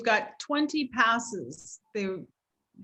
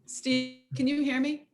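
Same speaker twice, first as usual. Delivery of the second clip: choppy breaking-up audio; call dropping out; 2 semitones higher than usual. Real, not acted. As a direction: voice cutting in and out over Zoom. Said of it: "You're breaking up a little bit".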